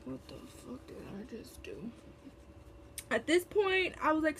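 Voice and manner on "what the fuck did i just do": whispered inner thought, disbelieving. Retold or imitated. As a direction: hush it to yourself as if caught out. Inner thought about giving free soda.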